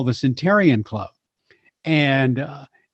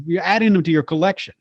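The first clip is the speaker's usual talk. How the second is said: normal talking voice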